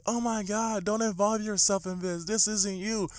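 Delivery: put-on high, soft pleading girl voice; a soft, worried plea to stay out of it. Crush warning him quietly.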